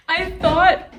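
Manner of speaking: laughing. Real, not acted. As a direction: laugh through the words. Laughing at own mistake.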